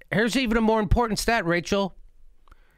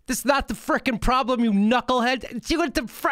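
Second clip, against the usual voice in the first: normal talking voice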